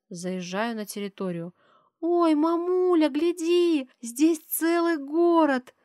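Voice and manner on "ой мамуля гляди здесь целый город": put-on high, slow, delighted little girl; delighted child. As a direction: gasp at it in wonder like a child. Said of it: "Дочь восторженно любуется".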